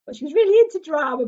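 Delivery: usual speaking voice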